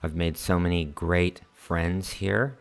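normal talking voice

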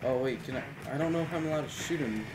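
ordinary delivery

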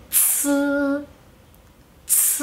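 "ts" as soft hissed ts with no i; silent I. Shown as a demonstration. Teacher showing the mute I after C, "ts" exhaled softly. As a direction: let air hiss through "ts". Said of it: "Es una I muda".

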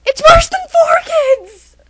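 high, loud, drawn-out cry; despairing wail. Acted. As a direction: wail it slowly in hammed-up despair.

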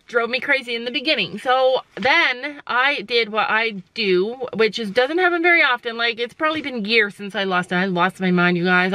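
ordinary talk

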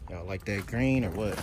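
usual speaking voice

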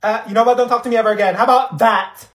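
ordinary talk, nothing marked

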